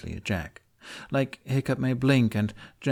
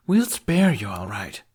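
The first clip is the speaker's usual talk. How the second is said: growled threat; 5 dB louder than usual. A narrator's reading. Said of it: "she growls".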